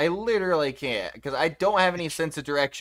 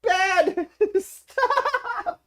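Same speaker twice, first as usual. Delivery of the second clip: squealing through laughter; high giggling protest; being tickled; 4 dB louder than usual. Real, not acted. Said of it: "He's tickling me again!".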